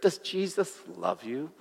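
hushed voice of the tempter; insinuating murmur. Acted. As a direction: plant a doubt, very softly in the ear. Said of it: "he says again into my ear".

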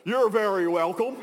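loud mock American accent; best American accent. French attendant replies to the tourist, in his best American accent. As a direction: imitate a hearty American accent for the reply. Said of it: "in his best American accent".

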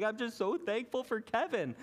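high, teary mock blubber; a put-on weepy voice for the friend; imitating sobbing. Friend at Thanksgiving dinner tearfully thanking for the speaker, imitated sobbing. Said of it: "she starts crying and, like, sobbing".

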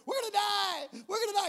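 high, loud mock wailing; crying panic. Terrified kid to his companion, wailing in panic. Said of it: "he starts crying".

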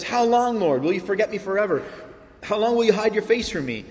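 high, pleading lament voice, rushed in the middle; acted anguished cry. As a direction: voice David's desperate plea to God. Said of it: "David is crying".

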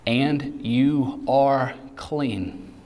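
very slow, drawn out words; preacher stressing the promise slowly; slow emphatic. Acted. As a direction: land each word slowly. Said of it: "listen to this".

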